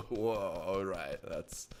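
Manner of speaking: low, soft stoner "whoa"; mock dazed pothead. Shown as a demonstration. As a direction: put on a mellow stoner. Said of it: "potheads is more".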